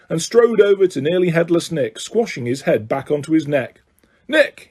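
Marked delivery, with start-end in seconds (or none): 4.3-4.7 high, hearty hail; roar. A narrator's reading. Sir Patrick greets Nick at the party, roaring. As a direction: hail an old acquaintance boisterously. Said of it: "he roared".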